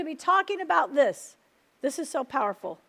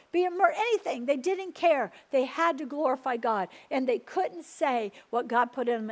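ordinary delivery

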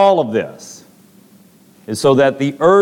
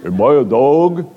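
VeggieTales Goliath; 2 semitones lower, slower than usual. Retold or imitated. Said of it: "that VeggieTales scene".

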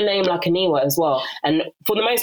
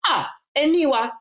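put-on high-pitched mum calling a child's name; imitated motherly summons; 8 semitones higher than usual. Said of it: "I hear my mum go".

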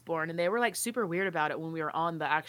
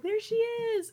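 excited; much slower than usual. Retold or imitated. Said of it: "it was like".

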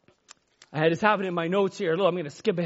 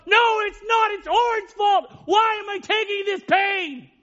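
screamed out; 10 semitones higher, slower than usual. Pretend. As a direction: cry out against the pain, drawn out. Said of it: "he could have screamed out".

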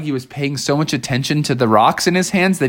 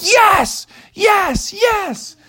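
high, gleeful yes yes yes; a thrilled little frog; Froggy cheering, high; happy yeses; much slower than usual. Acted. Froggy cheers while juggling.

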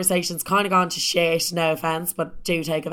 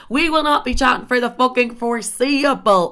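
defiant; 5 semitones higher, 5 dB louder than usual. Real, not acted. Defiant after his text.

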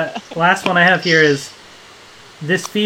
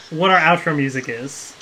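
ordinary delivery

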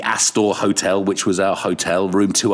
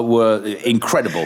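usual speaking voice

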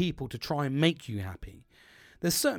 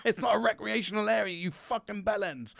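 higher-pitched mock indignation; hammed-up exasperated insult, pitched up; angry rant, retold; 7 semitones higher than usual. Volunteer berating people for turning up, retold angry rant. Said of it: "that's the level of anger".

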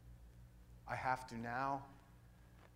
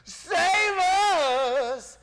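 long, high rock scream; 13 semitones higher, 13 dB louder, much slower than usual. Retold or imitated. Rock singer crying out to Jesus, screamed impression. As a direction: scream it out, stretching the words at full force. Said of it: "screams out like only [name] can".

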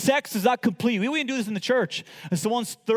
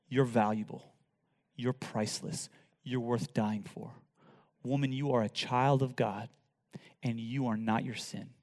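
tender soft preaching; 9 semitones lower, 7 dB quieter than usual. Acted. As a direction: lean in and comfort her gently.

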